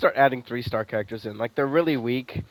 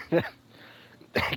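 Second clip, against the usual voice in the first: slow, laughing disbelief; can't stop laughing at the game; 4 semitones higher, 6 dB quieter than usual. Real, not acted. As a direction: laugh through it, amazed. Laughing at the character's lost soul.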